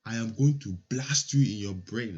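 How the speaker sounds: lightly voiced menacing official; a hint of an angry school official's threat; threatening superintendent. Superintendent threatening the children, lightly menacing voice. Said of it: "exactly what he said".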